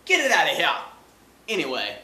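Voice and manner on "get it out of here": loud dismissal. Acted. Dismissing the kid.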